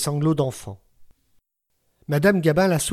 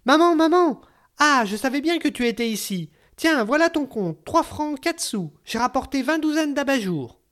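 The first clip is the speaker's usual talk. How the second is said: put-on much higher, fluting little girl crying out; piping child's voice; 11 semitones higher than usual. A narrator's reading. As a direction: call out like an excited ten-year-old girl. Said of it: "en criant de sa voix flûtée".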